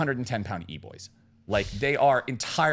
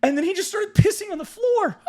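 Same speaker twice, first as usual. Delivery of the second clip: high-pitched, escalating horror; mock panic; 4 dB louder than usual. Frightened smaller guy recounting his escape, high mock panic. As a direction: climb into a squealed mock-distressed account. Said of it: "fear and terror in his eyes".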